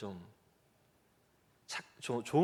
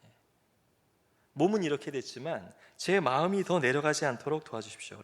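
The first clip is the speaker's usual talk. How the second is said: earnest prayer; 2 semitones higher, 4 dB louder than usual. Retold or imitated. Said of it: "정말 간절히 기도했습니다".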